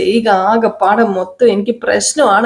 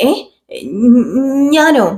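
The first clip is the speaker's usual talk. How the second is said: surprised; 5 semitones higher, much slower than usual. Acted. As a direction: caught off guard, me?. Shruthi shocked at cooking order. (ഞെട്ടലോടെ ചോദിക്കുന്നു)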